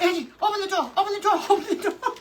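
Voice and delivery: high, quickening mock-panicked pleading; retold panicked plea. Mother-in-law begging Angie to open up, mock panic. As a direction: imitate frantic begging, breaking into a laugh.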